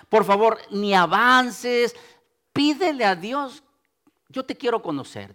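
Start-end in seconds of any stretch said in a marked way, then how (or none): 4.3-5.3 soft, intimate plea; humble prayer. Shown as a demonstration. Preacher shows listeners what to ask God, soft prayer. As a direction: model a simple prayer, hushed.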